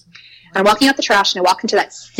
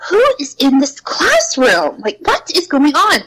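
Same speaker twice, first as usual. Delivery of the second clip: higher, slower, spooked question to an empty room; startled call; 6 semitones higher than usual. Retold or imitated. Teacher calling into an empty classroom, higher and spooked.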